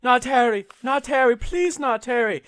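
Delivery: high, loud begging cry, acted out; desperate pleading. Reader voicing a mother begging for Harry, desperate pleading.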